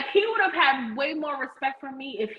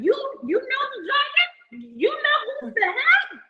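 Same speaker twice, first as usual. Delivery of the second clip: much higher, laughing, ranting shout; 11 semitones higher than usual. Real, not acted. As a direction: yell it through laughter, disbelieving.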